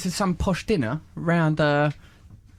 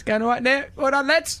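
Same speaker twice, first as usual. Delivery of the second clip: cheery passer-by; 8 semitones higher than usual. Retold or imitated.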